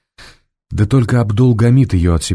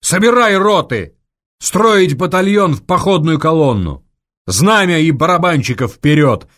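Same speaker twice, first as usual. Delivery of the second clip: commanding orders; 8 semitones higher, 3 dB louder than usual. A narrator's reading. General barking orders.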